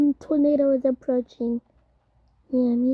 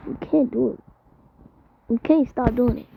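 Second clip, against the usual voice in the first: normal talking voice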